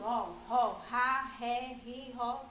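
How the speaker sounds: rolling out each strong R syllable ró, rá, ré, ri, ró with a firm guttural R. Shown as a demonstration. Teacher to children showing the strong R, syllable by syllable. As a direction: drill the syllables ró, rá, ré, ri, ró one by one, the R said hard. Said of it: "porque o som dela não é brando".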